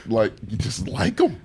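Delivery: ordinary delivery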